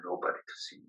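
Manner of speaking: a breathless, voiceless whisper; whispered despair. Real, not acted. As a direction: let the words almost vanish. Empty garden, family not there.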